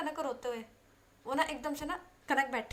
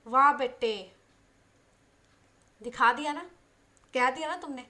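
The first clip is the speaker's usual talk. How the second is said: loud mocking "so you said it"; sarcastic outburst; 10 dB louder than usual. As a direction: throw the words back, much louder. (ताना मारते हुए ज़ोर से)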